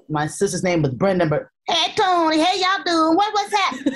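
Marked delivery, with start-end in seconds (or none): 1.7-4.0 high-pitched Southern country holler; Southern country accent. Shown as a demonstration. Woman recalling her childhood in Harlem, high country Southern accent. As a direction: put on a much higher country-kid Southern accent. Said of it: "I had an accent like that".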